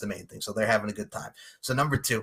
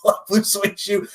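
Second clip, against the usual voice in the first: ordinary talk, nothing marked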